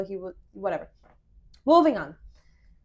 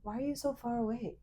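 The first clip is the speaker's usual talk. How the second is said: softly imitating a boyfriend making up; quiet, gentle retold question; softened up; 9 dB quieter than usual. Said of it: "he softens up and he goes".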